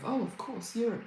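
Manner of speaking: normal talking voice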